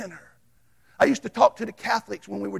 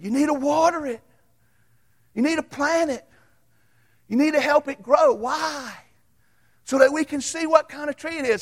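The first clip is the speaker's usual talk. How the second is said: put-on high, louder children's voices; kids answering; 6 semitones higher, 3 dB louder than usual. Kids telling the teacher what the tree needs. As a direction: answer eagerly like little kids. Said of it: "they said".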